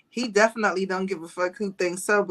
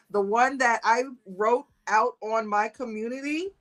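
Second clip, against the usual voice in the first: normal talking voice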